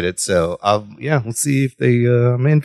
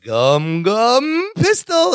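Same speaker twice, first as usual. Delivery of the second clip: drawn-out, rising attack announcement; mock anime move call, very slow; anime battle call; 6 semitones higher, much slower than usual. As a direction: stretch each word, climbing higher like an anime hero. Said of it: "they announce their battle moves".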